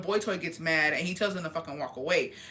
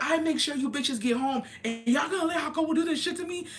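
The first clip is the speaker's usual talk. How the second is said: indignant complaint; 7 semitones higher than usual. Retold or imitated. Complaining on phone.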